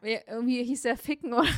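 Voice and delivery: bursting out laughing. Real, not acted. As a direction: laugh through the name.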